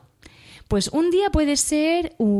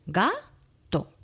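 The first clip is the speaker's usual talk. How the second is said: "gato" slowly split into ga-to; syllable by syllable. Shown as a demonstration. Teacher counting syllables of "gato" for children, slow split. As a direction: stretch out "gato", each syllable apart. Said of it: "contar el número de sílabas que tiene la palabra".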